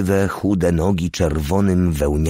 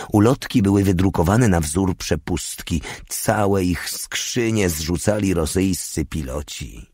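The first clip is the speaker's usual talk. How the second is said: plain reading voice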